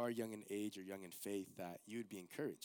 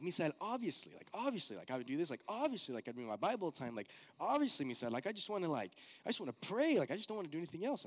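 imitating eager friend; 6 semitones higher, 3 dB louder, faster than usual. Retelling friend's devoted words.

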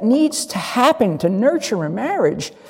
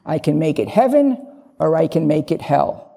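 normal talking voice

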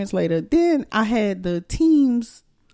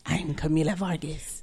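slow, low raspy impression; soft, slow character voice with rasp; Camila Vargas; 4 semitones lower, 7 dB quieter than usual. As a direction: introduce yourself in a hushed, raspy drawl. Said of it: "with her little raspy voice".

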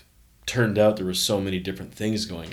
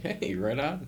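put-on pleased kid; 7 semitones higher, 3 dB quieter, slower than usual. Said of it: "I'm like".

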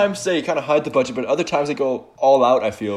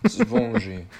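mock French accent; 8 semitones higher, 5 dB quieter than usual. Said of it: "most pivotal Frenchie scene".

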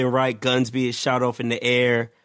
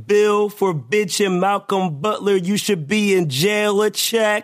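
raised, declaimed punchline delivery; announcer-style roll call; 7 semitones higher than usual. Acted. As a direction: proclaim the punning alias like a ring announcer. Performs punning nickname roll call.

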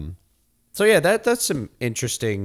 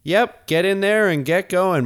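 loud hurry-up; 9 semitones higher, 5 dB louder than usual. Pretend. Hustling guest into time machine.

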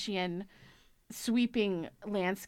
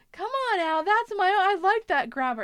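much higher, louder pleading voice; imitated movie character; 11 semitones higher, 7 dB louder than usual. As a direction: mimic an old man wanting his grabber. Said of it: "I can't do it justice".

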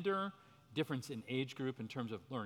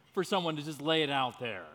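ordinary talk, nothing marked

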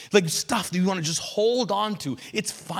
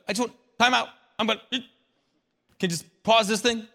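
pleading for escape; 3 semitones higher, much faster than usual. Acted. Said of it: "have you ever had a moment like".

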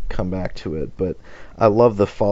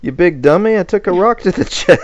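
high, loud mock indignation; hammed-up, high-pitched comic outrage; Hector; 11 semitones higher, 7 dB louder than usual. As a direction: protest loudly at a clueless friend. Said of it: "Hector's like".

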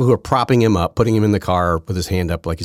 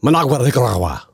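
Managua, Nicaragua in an exaggerated Spanish accent, higher; mock Spanish accent; 6 semitones higher, 4 dB louder than usual. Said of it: "it's like saying".

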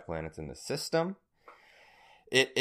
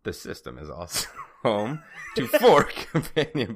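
laughing; 3 semitones higher, 7 dB louder than usual. Real, not acted. Reading while laughing.

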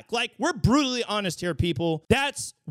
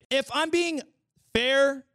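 normal talking voice

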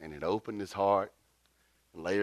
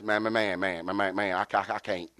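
imitated stutter; 3 semitones lower than usual. American guy dodging the diaper job, mock stutter. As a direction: stumble over repeated words, imitating panic. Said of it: "but he started stuttering".

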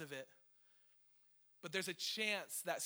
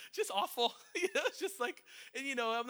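laughing through pain; 8 semitones higher, 4 dB louder than usual. Real, not acted. Laughing through a painful memory.

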